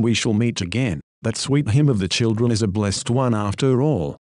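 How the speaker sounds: ordinary talk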